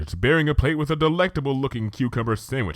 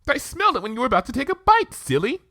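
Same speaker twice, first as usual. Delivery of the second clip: higher, bouncy pony; chirpy and cheerful; Pinkie, bubbly; 4 dB louder, faster than usual. Acted.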